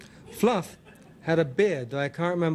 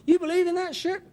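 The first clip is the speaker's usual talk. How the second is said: furious grinning challenge. Acted. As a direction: throw the accusation at him. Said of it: "grinning at me furiously".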